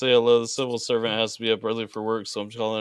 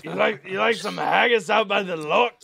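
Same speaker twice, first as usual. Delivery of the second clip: high-pitched Scottish impression; put-on Scottish accent, pitched up; mock Scottish accent; 6 semitones higher than usual. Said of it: "No, bot, that was Scottish".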